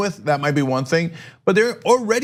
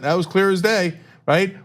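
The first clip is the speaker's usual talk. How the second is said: ordinary talk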